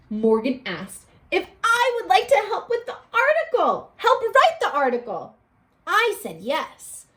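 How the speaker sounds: storybook read-aloud voice; bright read-aloud for a class; expressive classroom storybook reading; reading to children. Acted. Teacher reads story aloud.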